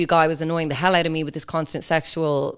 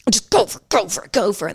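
louder, higher mock urging; mock aggressive egging-on; 4 semitones higher, 3 dB louder than usual. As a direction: chant it like an over-keen sexter. Mocking men's aggressive sexting.

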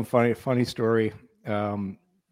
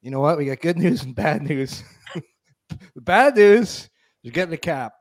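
imitating a teammate's big announcement, higher and louder; mock team rep announcement; 5 semitones higher, 5 dB louder than usual. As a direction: ham up the good news bad news pitch to the team. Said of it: "he goes".

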